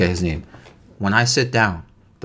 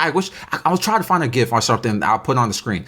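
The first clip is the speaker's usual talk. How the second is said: ordinary delivery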